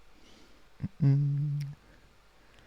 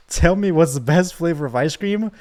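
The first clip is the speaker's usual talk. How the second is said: hammed-up villain demand; threatening demand; 4 semitones higher than usual. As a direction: force the answer out of them. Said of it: "Now try it again".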